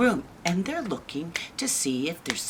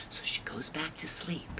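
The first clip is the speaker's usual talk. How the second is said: plain reading voice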